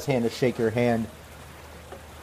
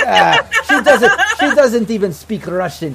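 exasperated laugh; 8 semitones higher, 9 dB louder than usual. Acted. Boris exasperated at Galina.

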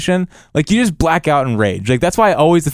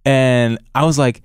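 ordinary talk

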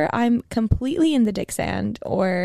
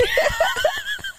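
a shrill laughing squeal, much higher. Real, not acted. Laughing at her own fake promise, high squealing laugh.

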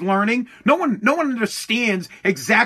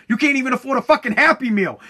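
usual speaking voice

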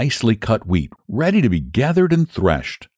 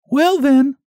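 much higher hen voice, resigned; little red hen; 3 dB louder than usual. Acted. Hen answering the lazy animals, high resigned voice. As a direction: shrug and take it on yourself. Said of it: "said the little red hen".

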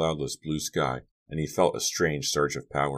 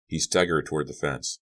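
usual speaking voice

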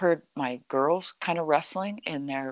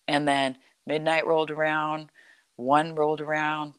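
ordinary delivery

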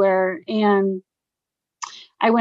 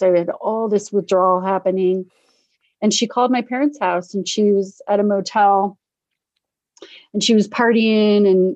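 ordinary delivery

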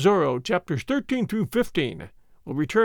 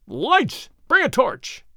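high, loud shriek; 7 semitones higher, 3 dB louder, much slower than usual. Acted. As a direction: shriek in the dark. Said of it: "Gonzalez shrieked".

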